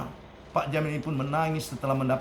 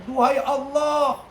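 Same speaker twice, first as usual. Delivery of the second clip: mother's anguished prayer; 11 semitones higher than usual. Acted. Mother crying out to God after her son confesses. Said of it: "ibunya pun langsung berkata".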